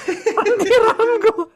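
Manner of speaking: slow, high laughing repeat. Real, not acted. Co-host mocking the wrong name, laughing. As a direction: stretch it out through laughter.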